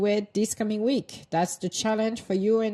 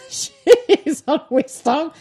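laughing; 8 semitones higher, 10 dB louder, much slower than usual. Real, not acted. Laughing at the coincidence.

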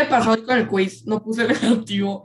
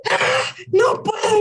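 mock crying; 11 semitones higher, much slower than usual. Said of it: "la Andrea en medio Zoom está".

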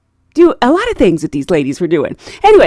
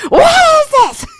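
very high, loud mimicked screech; yelling old lady; 6 dB louder, much slower than usual. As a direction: yell it obliviously over headphones. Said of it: "are yelling about the exact".

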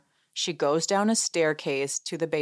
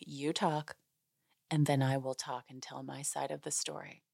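much softer, measured mock-mature tone; mock grown-up calm; 3 semitones lower, 11 dB quieter than usual. Angie calmly claiming her turn, soft and composed. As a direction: lay it out sweetly calm, like the adult in the room. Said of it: "Angie is being very grown up about this".

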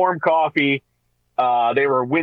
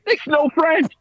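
indignant; 12 semitones higher, slower than usual. Real, not acted. Punchline, higher.

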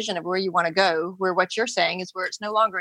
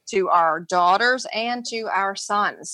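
slow emphasis; much slower than usual. Real, not acted.

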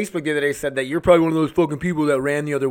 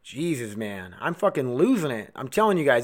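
ordinary talk, nothing marked

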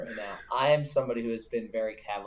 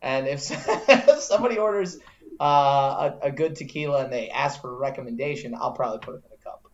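laughing; 2 semitones higher, 6 dB louder than usual. Real, not acted. Laughing while confessing.

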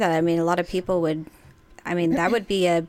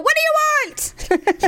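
high, exasperated mock shriek; mock irritated yell; faster than usual. Friend imitating her answer to him, high mock yell. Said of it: "It's my demon voice".

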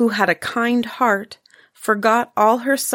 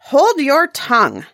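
angry stepmother; 5 semitones higher, 4 dB louder than usual. Acted. Stepmother snaps at girl.

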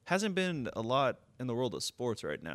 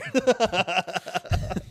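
slow, stretched, loud hyped yell; dragged-out hyped insult, sports-host style; Pat McAfee impression; 8 semitones higher, 5 dB louder, much slower than usual. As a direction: drag out the insult like a hype man. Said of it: "Shout out Pat McAfee".

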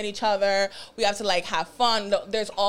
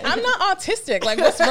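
high, loud mock indignation; indignant protest; 9 semitones higher, 4 dB louder than usual. Answering partner who talks down to them, indignant protest. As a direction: act offended, pitched up and pushing louder. Acting own protest at being patronized.

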